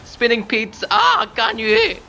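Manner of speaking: high shout rising to a drawn-out shriek; shout, then scream the name slowly. Real, not acted. Player's attack goes wrong, screaming at Gan Yu. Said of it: "Sorry for screaming".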